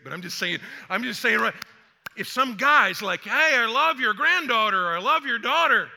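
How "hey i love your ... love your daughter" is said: loud, raised-pitch suitor's boast; mock declaration. Some guy telling a father about his daughter, loud mock declaration. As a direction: proclaim it loudly like an overeager suitor.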